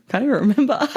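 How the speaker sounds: laughing. Real, not acted. Podcast host laughing at losing her thread, laughing. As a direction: break into laughter while talking.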